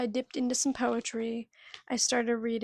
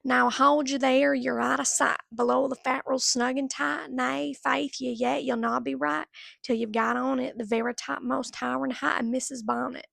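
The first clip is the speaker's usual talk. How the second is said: Burns verse in a Southern accent; 4 dB louder than usual. Shown as a demonstration. Vlogger shows viewers how she read Burns, Southern accent. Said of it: "reading it in a Southern accent".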